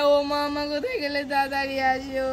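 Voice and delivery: hammed-up sobbing call; child bawling for help, put on; fake crying. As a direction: wail for mother as if hurt. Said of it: "কান্না করছিস কেন?".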